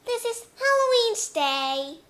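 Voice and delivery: put-on loud, high kids' chant, slow on trick or treat; children saying trick or treat. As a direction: shout it like excited kids at the door. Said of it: "And the children will say".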